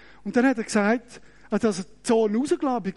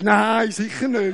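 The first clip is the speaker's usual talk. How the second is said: slow, firm fake denial; mock denial; 4 dB louder, slower than usual. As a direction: deny it with a straight face. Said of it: "Und ich". (langsam und gespielt überzeugt abstreiten)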